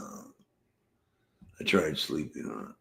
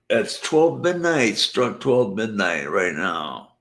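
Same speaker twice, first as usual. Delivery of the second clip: higher, louder announcement of midnight; excited exclaim; 6 semitones higher, 6 dB louder than usual. Real, not acted. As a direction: announce the hour like big news. Exclaiming the hour.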